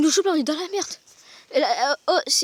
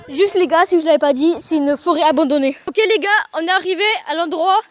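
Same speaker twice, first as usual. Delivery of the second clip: loud excited; 9 dB louder than usual. Real, not acted. Excited arrival, shouting to viewers.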